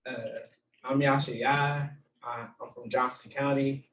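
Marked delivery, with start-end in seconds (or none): none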